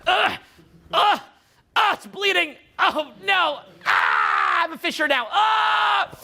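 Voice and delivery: high, strained grunts and cries; hammed-up straining in agony; pushing it out. Coach mocking someone forcing the voice out, exaggerated straining. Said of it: "you try to push it out".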